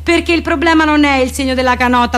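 ordinary talk, nothing marked

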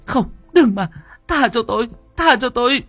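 very high, desperate pleading cry; terrified begging. A narrator's reading. Đào Nương imagines needles going in, terrified. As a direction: plead for mercy at the top of the voice. Delirious girl begs for mercy.

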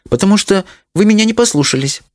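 put-on high-pitched little girl owning up; confessing child. A narrator's reading. Daughter owning up to parents, confessing. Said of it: "решила признаться Леночка".

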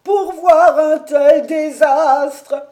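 high, loud, slow wailing lament; broken breathless cry. A narrator's reading. Old servant crying out to his master the tower burns, high broken wail. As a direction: cry out in broken gasps of despair. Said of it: "on l'entendit crier d'une voix entrecoupée".

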